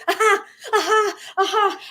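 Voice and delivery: high, laughing little bursts of aha; delighted discovery. Acted. Coach acts out spotting small wins, delighted. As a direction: pop each aha out like a fresh find. Acts out noticing abundance flowing in.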